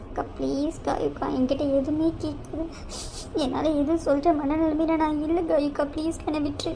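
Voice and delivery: put-on a hushed tearful plea to the older sister; tearful pleading. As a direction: plead quietly through held-back tears. Tearful Suni begs sister.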